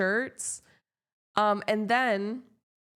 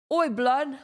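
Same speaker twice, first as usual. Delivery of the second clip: loud, higher British street call; mock British; 5 semitones higher, 4 dB louder than usual. Streamer imitating British people to chat, mock British accent. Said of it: "how British people be like".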